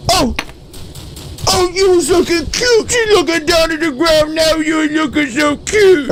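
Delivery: high squealing praise; gushing. Pretend. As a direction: squeal over how cute she looks. Gushing at cute character.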